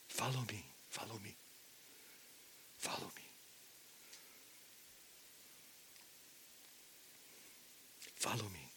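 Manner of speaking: very soft, near-whispered call; hushed appeal. Acted. Preacher as Jesus calling disciples to follow, in a hushed appeal. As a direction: barely voice it, with pauses between repeats.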